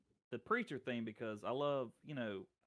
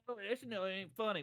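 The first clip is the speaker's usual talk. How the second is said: mocking complainer; 9 semitones higher than usual. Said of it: "I know people are like".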